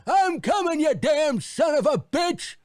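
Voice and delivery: put-on high, slowed old man grumbling; cranky old husband shuffling to the phone; old husband grumbling at his wife; grumpy old husband. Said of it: "old married couple demo".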